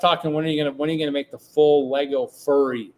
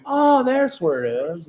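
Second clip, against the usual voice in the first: slow, higher "oh there it is"; mock discovery; 5 semitones higher, 3 dB louder, slower than usual. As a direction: act out finding it, slowly. Acting out finding stuff.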